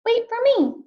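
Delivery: high, small pleading fish voice; pleading little fish. Acted. Little blue fish begs Rainbow fish for a scale, high and pleading. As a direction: beg sweetly like a tiny fish.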